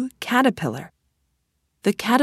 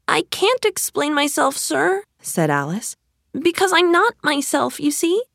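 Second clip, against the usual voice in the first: put-on little girl voice; 8 semitones higher than usual. A narrator's reading. Alice voiced in a story.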